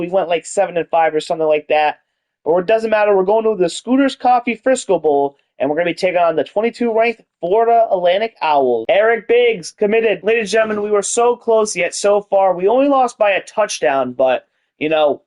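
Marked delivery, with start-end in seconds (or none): 2.4-14.1 mock announcer. Bowl announcement in host voice.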